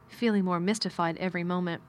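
plain reading voice

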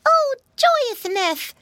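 very high, loud, drawn-out piggy squeal; joyful squeal. A narrator's reading. Piggy brother rejoices at the trip, squealing slowly. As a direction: stretch it out in squealing delight. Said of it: "squealed Flop".

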